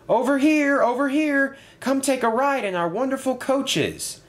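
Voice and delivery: very high, eager hailing call. A narrator's reading. Thomas hails passengers to his coaches, calling out.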